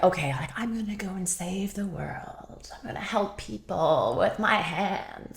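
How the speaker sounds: soft, hushed dreamer quoting herself; mock naive idealism. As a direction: play the earnest would-be world saver, softly. Mocking own naive idealism.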